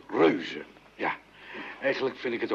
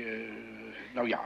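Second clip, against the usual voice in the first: usual speaking voice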